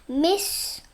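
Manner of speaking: ordinary delivery, nothing marked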